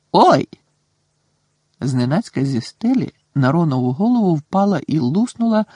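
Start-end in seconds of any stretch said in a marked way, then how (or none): 0.1-0.5 sudden high, loud "Ой!"; startled yelp. A narrator's reading. Ron yelps as a water bomb bursts on him.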